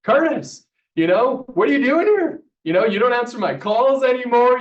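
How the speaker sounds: imitating a yelled challenge across an office; retold yelling. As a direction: holler it as an indignant mentor, retold. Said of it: "Jay yells my name".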